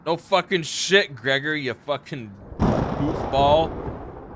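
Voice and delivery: loud, slow, drawn-out exasperation; exasperated yell. Real, not acted. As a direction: yell it at the screen in disbelief. Yelling at game character Gregor.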